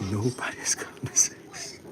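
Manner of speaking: slow, hushed whisper of the motto. Shown as a demonstration. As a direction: breathe it out slowly like a secret. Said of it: "Say it slowly".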